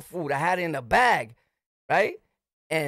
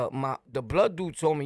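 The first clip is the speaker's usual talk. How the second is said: ordinary talk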